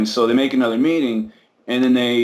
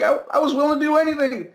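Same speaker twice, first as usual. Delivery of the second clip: earnest plea; 13 semitones higher, faster than usual. Retold or imitated. Cousin pleads to his dad.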